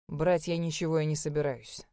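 low, cold and cutting; icy tone. Acted. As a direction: freeze her out with each word. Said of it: "ледяным тоном ответил Эйден".

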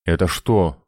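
ordinary delivery

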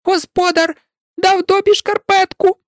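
very high squeaky elf voice, astonished; amazed house-elf. A narrator's reading. Dobby marveling at the sock from his master, amazed. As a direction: squeak out the wonder like a tiny elf. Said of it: "здивовано вимовив ельф".

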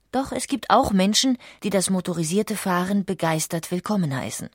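ordinary delivery, nothing marked

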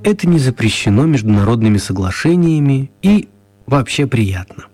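ordinary delivery, nothing marked

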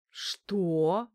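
громкое растерянное «что»; ахни от изумления; ошарашенно. A narrator's reading. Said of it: "вытаращился на меня Ефим". (ошеломлённый Ефим переспрашивает)

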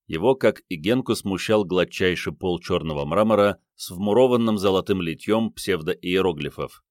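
plain reading voice

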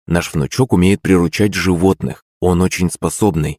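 usual speaking voice

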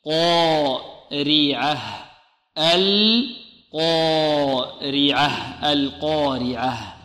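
القارعة very slow, heavy q, final ha made clear; slow clear recitation. Shown as a demonstration. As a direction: recite القارعة slowly, sounding the ha. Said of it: "نقول هكذا".

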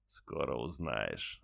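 very slow, low dying rasp with a smile; faint deathbed whisper. A narrator's reading. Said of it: "ответил солдат и улыбнулся".

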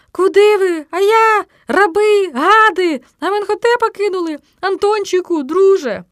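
high, loud pleading yells; desperate shrieking. A narrator's reading. Trapped boy calling fleeing friends back, desperate. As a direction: yell after them, abandoned and hopeless. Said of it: "безнадійно вириснув я".

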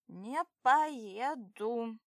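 slow loud slurred refusal; drunk refusal. Acted. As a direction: drag it out, drunk. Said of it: "подаю голос".

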